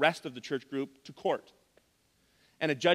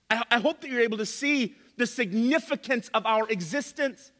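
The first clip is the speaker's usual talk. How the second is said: higher, emphatic pulpit delivery; impassioned preaching; 8 semitones higher, 4 dB louder than usual. Acted. Preacher urging the church not to miss its significance, impassioned. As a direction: preach it with rising force and weighty pauses.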